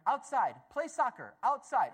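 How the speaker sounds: a little boy set on playing right now; eager toddler. Toddler tells his dad at night he wants soccer, insistent. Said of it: "And he's up there going".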